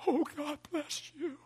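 imitating the lady's relieved blessing; relieved gratitude. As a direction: let all the pressure drain out. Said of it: "Watch what she did".

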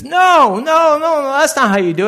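high, loud cry of no; retold shout. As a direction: shout him down like a friend stopping you mid-cut. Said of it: "and he says".

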